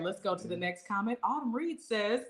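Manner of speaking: ordinary delivery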